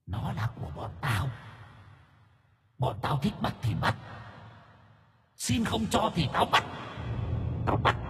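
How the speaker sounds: put-on very soft, eerie child-demon voice; menacing ghost children. Two ghost children claim Yến's baby, menacing. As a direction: menace her in a hushed, far-away voice. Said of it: "Hai đứa bé gằn lên, cùng cất tiếng đồng thanh".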